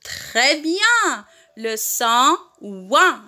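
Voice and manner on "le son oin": slow, drawn out for repeating; teacher's slow repeat-after-me delivery; slow model pronunciation. Shown as a demonstration. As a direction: model it slowly, stretching "ouin" with a falling end. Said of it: "Répétez avec moi".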